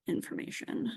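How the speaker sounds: ordinary delivery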